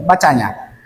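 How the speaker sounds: usual speaking voice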